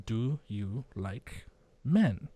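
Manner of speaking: Dell asks very slowly, quiet and casual; slow voiced Dell. Host voicing Dell's question to Marty, drawn out slowly. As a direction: stretch every word out, unbothered.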